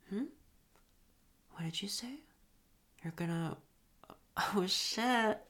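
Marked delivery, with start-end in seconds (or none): 4.5-5.4 sudden louder whispered "oh shit"; surprised gasp. Acted. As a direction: caught off guard, blurt it out. Partner climaxes suddenly.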